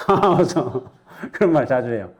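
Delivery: normal talking voice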